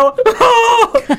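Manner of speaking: loud, high mock bawl; loud fake crying. Shown as a demonstration. Bride showing she cries at marrying, loud fake wail. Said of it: "哭较大声".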